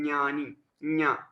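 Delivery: ज्ञानी and ज्ञा said as nyani, nya; palatal-nasal pronunciation. Shown as a demonstration. Teacher repeating for pupils, ज्ञानी, ज्ञा as nyani, nya. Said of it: "इसका उच्चारण है".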